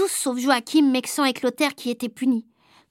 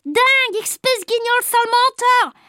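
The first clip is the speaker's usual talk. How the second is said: put-on high, proud child voice; 9 semitones higher, 3 dB louder than usual. New boy proudly repeats insults, higher. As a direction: show off the new words. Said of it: "a dit Jojo tout fier".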